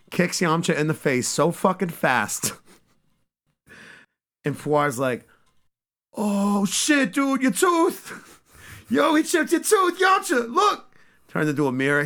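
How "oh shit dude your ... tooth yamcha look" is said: very high squeaky voice, shouting and laughing; squeaky Puar. Retold or imitated. Puar telling Yamcha his tooth got chipped, squeaky and laughing. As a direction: squeal it out like a tiny flying cat.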